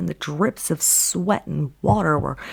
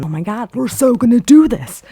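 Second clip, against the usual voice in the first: loud, higher burst of excitement; excited cheer; 5 semitones higher, 8 dB louder than usual. Retold or imitated. Contestant psyching up to finish the climb, excited. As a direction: pump yourself up, gleefully. Hopeful about winning the challenge.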